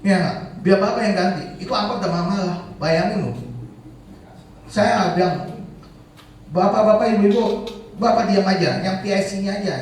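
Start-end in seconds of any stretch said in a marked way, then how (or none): 0.7-1.4 loud, higher mock threat; retold angry threat. Angry driver making the organiser pay, retold loud threat. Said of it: "ngancem-ngancem". 6.5-9.7 loud, higher-pitched call for order; retold crowd address. Organiser addressing angry drivers, retold loud call for order.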